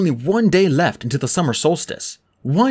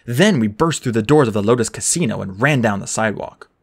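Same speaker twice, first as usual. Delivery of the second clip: usual speaking voice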